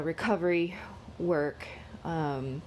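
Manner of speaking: ordinary delivery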